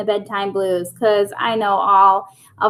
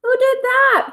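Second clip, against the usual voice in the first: high, startled question; surprised Pete; 13 semitones higher than usual. Acted. Pete woken by noise, high startled question. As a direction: Pete jolted awake asking who did it.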